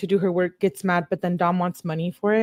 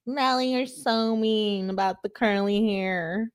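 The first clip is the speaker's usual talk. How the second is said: slow, softer mocking drawl; whiny commenter; 5 dB quieter, slower than usual. Reader imagining someone in the comments scolding her, mock complaint. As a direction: mimic a complaining commenter, dragging the words out. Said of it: "someone in the comments".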